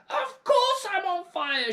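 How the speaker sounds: high, loud, slow furious yell. A narrator's reading. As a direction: howl it back at him. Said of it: "she yelled".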